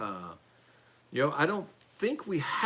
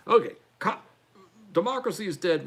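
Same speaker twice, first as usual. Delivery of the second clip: ordinary talk, nothing marked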